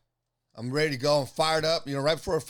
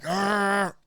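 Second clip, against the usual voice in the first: loud, drawn-out mock growl; frustrated growl; 3 semitones higher, 4 dB louder than usual. Fighter annoyed in his zone, loud growl. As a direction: growl like you're amped for a fight.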